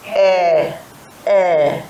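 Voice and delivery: a hint of a deaf old person asking "Eh, eh"; mock hard of hearing. Shown as a demonstration. Teacher voicing a girl pretending not to hear. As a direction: play an older person who can't hear. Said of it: "What sound does it make".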